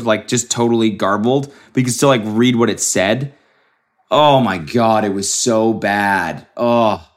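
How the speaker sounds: usual speaking voice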